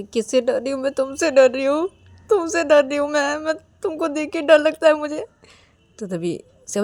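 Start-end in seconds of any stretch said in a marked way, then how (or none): none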